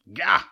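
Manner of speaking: a surprised, annoyed yelp; startled gah. A narrator's reading. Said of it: "he said".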